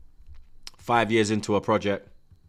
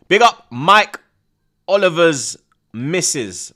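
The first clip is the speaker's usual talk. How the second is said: high, loud, drawn-out call; loud shoutout; 6 semitones higher, 11 dB louder, slower than usual. Real, not acted. Streamer greeting the referee's wife, loud teasing shoutout. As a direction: hype up the chat guest.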